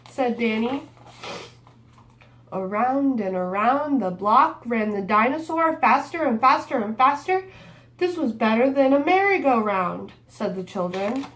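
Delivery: lively picture book read-aloud; reading to children. A narrator's reading. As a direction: read it like storytime for little kids.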